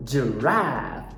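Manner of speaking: giraffe with the American ash vowel; low American giraffe, front A; American accent. Shown as a demonstration.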